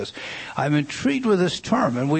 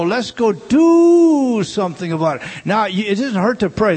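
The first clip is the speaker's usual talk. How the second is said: louder, driving sermon voice; preaching at full force; 6 semitones higher, 7 dB louder than usual. Acted. Preacher urges the church to act, louder preaching. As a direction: preach it hard to the congregation. Preaching hard against prayer meeting.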